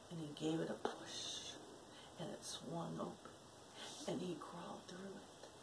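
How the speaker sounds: whispered, creeping narration; hushed suspense. A narrator's reading. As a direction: draw the child in with a hush.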